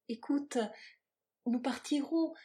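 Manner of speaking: high, lilting, eager tenderness; melodious tenderness. A narrator's reading. Woman persuading her lover to flee together, tender lilt. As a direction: coax him with a rising dream. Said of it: "ce ton de suave mélodie qui était le chant de sa tendresse".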